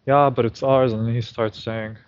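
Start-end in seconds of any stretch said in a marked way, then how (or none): 0.1-0.9 retold loud claim. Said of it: "She's like".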